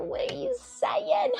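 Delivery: ordinary talk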